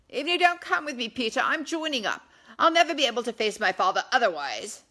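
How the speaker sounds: loud, high, quickening vow; excited exclamation. Acted. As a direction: blurt out the resolve with fervour. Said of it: "Edward exclaimed".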